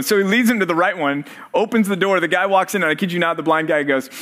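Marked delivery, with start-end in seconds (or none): none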